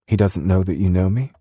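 high British woman's voice; British accent. A narrator's reading. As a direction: ask warily in a British accent. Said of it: "in her soft British accent".

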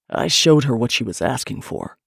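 low-pitched male retort; defiant man. A narrator's reading. Man answers his lover's accusation, defiant.

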